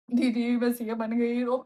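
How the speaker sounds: usual speaking voice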